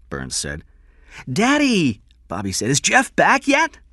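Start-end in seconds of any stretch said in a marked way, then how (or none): 1.3-2.0 put-on very high, loud child's call; small boy. Young son calling to his father, high child voice. 2.7-3.8 put-on very high, slow child's question; small boy. Young son asking father about his friend, high child voice.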